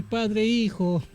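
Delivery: ordinary delivery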